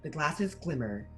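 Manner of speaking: ordinary talk, nothing marked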